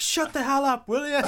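high, loud hammed-up shout; mock yell. Quoting an annoyed movie character to a friend, mock yelling. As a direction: bark it up high and loud, half joking. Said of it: "It's like".

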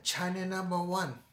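imitating a Chinese accent, high; hammed-up accent, chanting the boast; mock Chinese accent. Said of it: "He said".

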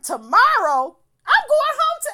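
high, loud, disbelieving shout; outraged yell. Retold or imitated. Telling off a date who wants her to stay, yelling. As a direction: blast it like the offer is insane. Outraged retelling of date's offer.

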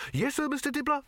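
high-pitched deferential reply; eager underling. A narrator's reading. Artist scurries up to boss.

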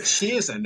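"Cheesin" said like cheese, long EE, pitched high; wrong name pronunciation. Retold or imitated. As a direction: say "Cheesin" as CHEE-zin, mocking the common mistake. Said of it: "It's like two S's, not two E's".

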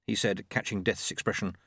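plain reading voice

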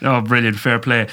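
usual speaking voice

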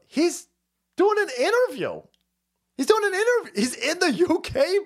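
very high-pitched disbelief; incredulous outburst. Retold or imitated. Streamer reacting to a leader's interview, high incredulous outburst. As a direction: let the voice leap way up, incredulous.

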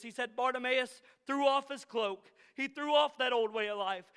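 ordinary talk, nothing marked

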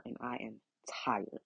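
tired, whispered and drained; exhausted whisper, fed up; weary whisper. Real, not acted. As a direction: let it sink to a whisper.

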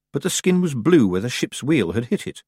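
ordinary delivery, nothing marked

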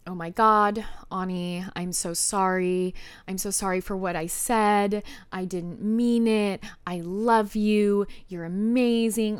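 slow, drawn-out reading of an apology text; stretched, unimpressed imitation of apologizing; mock apology. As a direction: recite the pleading text with no conviction. Reads boyfriend's apology text mockingly.

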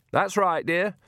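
put-on high, sing-song old lady; fake old woman voice. A narrator's reading. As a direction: coax sweetly, a bit high. Fake Nanny voice.

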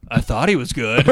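mock-humble, shrinking a bit as you say it. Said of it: "in the most humble voice ever".

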